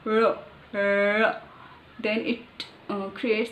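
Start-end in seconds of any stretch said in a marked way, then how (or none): 0.0-1.4 "Ro, ro" with a fast tongue flip forward; tapped ro. Shown as a demonstration. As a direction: push the tongue back, then flick it on "Ro, ro". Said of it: "flip it forward like this fast".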